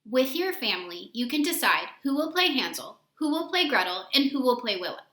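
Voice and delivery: usual speaking voice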